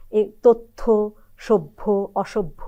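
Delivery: তথ্য, সভ্য, অসভ্য said slowly, doubled and ending in o; slow careful তথ্য সভ্য অসভ্য; slow demo words. Shown as a demonstration. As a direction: spell out each word slowly for learners. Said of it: "তাহলে".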